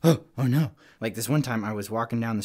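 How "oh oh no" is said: loud mock fright with a gasped start; spooked. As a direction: act startled, louder and a bit higher. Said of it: "I mean, like, really spooked".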